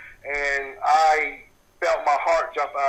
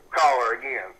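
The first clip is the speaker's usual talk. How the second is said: ordinary talk, nothing marked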